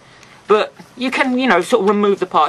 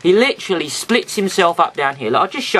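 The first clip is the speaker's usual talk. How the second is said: ordinary talk, nothing marked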